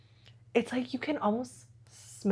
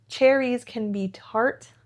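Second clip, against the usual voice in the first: ordinary talk, nothing marked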